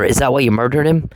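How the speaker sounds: ordinary talk, nothing marked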